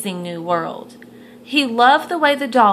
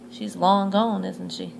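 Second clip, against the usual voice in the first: plain reading voice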